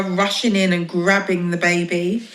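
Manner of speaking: usual speaking voice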